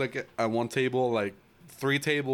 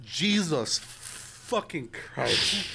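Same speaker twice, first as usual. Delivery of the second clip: drawn-out, higher exasperated oath; slow exasperation; 4 semitones higher, much slower than usual. Retold or imitated. Man reacting to maskless tourists, slow exasperated disbelief. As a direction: stretch each word in disbelief.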